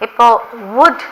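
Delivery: usual speaking voice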